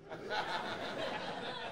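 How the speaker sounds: soft breathy laugh; mock laugh; imitated laugh. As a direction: laugh along like an amused celebrity. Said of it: "Will's laughing".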